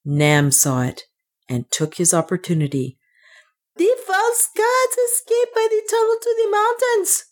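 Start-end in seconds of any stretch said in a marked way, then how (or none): 3.8-7.2 very high, strained cry at normal volume; scream. A narrator's reading. Priest alerting his people through the hole, screamed. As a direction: cry out high to those above. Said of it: "he screamed".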